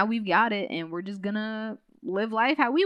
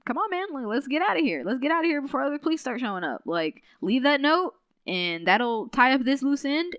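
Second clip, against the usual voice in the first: higher, hurried retold plea to leave; urgent urging; 5 semitones higher than usual. Helen urging Carl to get out before police come, urgent. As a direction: hustle a friend along, pitched up and quick. Acted urging to flee the scene.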